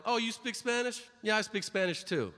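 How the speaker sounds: mock someone keen to claim a skill; lightly hammed-up enthusiasm; eager mock chirp. Imitates eager people claiming Spanish.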